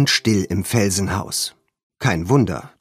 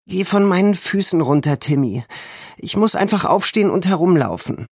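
put-on restless girl; 8 semitones higher than usual. A narrator's reading. George in pain tells Timmy.

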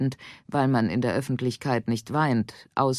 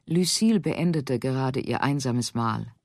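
ordinary delivery

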